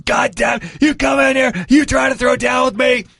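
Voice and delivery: high, strained furious shout; imitated angry yelling. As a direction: scream the challenge like an enraged fighter. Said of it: "He's still yelling".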